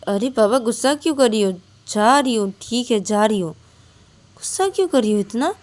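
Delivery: why so angry, half placating; taken aback. Acted. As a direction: friend startled by the snapping. Ananya reacts to anger.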